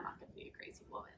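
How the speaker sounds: fast, breathy whisper to herself; whispered vow. Retold or imitated. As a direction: whisper it like a private promise. Self-talk quote about crazy women.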